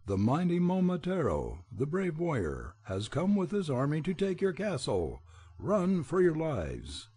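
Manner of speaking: high, ringing bird voice announcing; a shrill herald's call, slowing on the last words; shrill proclamation. A narrator's reading. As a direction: cry out the warning to the ogres. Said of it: "screamed with a shrill voice".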